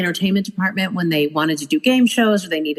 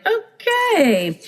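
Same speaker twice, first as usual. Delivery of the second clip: mock chipper okay; 12 semitones higher than usual. Deadpan reply to trainer.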